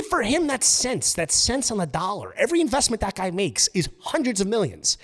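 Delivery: usual speaking voice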